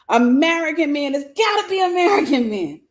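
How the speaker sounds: high, loud mock wail of blame; mock complaint. Woman mimicking her old perspective on men, high mock complaint. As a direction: whine it high, sending up your old self.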